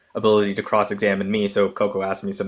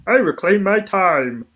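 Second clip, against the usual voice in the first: high, slow put-on voice; squeaky mock declaration; Coco impression; 9 semitones higher, 4 dB louder, slower than usual. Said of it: "Coco can go".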